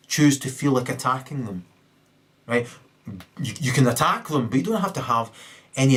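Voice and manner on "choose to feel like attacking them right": ordinary delivery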